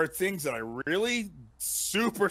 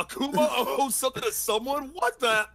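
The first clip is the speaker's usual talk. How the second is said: higher, laughing incredulous quote; laughing disbelief; 8 semitones higher than usual. Retold or imitated. Retelling his reaction to the trailer, laughing disbelief. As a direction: giggle through the shocked question.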